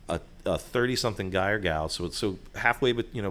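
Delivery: ordinary talk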